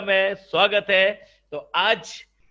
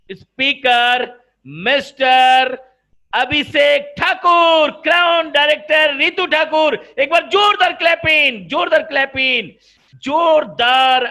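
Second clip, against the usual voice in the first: announcer shout; 7 semitones higher, 8 dB louder than usual. Real, not acted. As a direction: call the guest in like a stage emcee. Host announces guest to applause.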